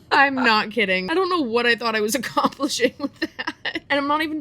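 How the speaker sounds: laughing loud at her own clip. Real, not acted. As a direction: crack up while telling it.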